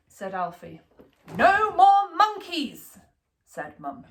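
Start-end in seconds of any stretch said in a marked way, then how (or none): 1.3-3.0 slow, firm, louder and higher; stern mum. A narrator's reading. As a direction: spell out the rule to a naughty child. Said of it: "mum laying down the law".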